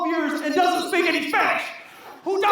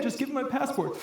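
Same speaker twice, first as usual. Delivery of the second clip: low, softened instruction; unbothered friend; 4 semitones lower, 8 dB quieter than usual. Acted. Friend to panicking driver at border, quietly calm. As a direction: say it low and under control.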